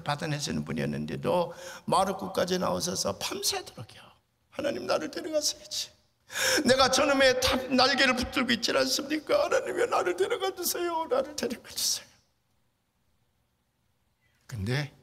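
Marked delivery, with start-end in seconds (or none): 4.5-11.1 father's anguished prayer. Retold or imitated. Bedridden father pleading with God all night, anguished. 11.1-12.1 breathless whispered begging; whispered plea. Retold or imitated. Father ending his prayer, barely whispering.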